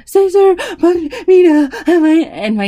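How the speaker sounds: put-on high, loud wailing little girl; crying child. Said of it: "And she said".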